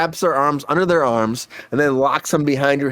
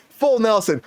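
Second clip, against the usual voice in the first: higher, fired-up call of the move; excited; 7 semitones higher than usual. Real, not acted. Telling friends about the wrestling hold, excited. Excited retelling of Bane story.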